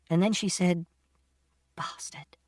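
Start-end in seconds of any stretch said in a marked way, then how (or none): 1.7-2.4 very soft whisper, almost no voice; whispered curse. A narrator's reading. Mother cursing after apologising to her son, whispered.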